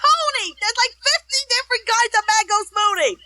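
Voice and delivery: exasperated mock yell, pitched high; wife shouting back through the window; retold yell back. Wife through a closed window, exasperated mock yell. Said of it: "she yells back at him".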